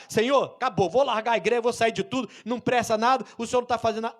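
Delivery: a believer giving up in protest; complaining outburst. Retold or imitated. Weak believer complaining to God. (crente fraco reclamando alto com Deus)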